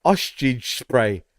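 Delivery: "ostrich spray" very slow, slurred into "oshtidge spray"; drawn-out mispronunciation. Shown as a demonstration. Host shows why the name makes him laugh, "ostrich spray" slurred slowly. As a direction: show off the silly sound of "ostrich spray", stretched out. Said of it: "just the way it sounds".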